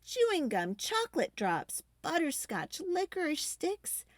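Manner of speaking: ordinary delivery, nothing marked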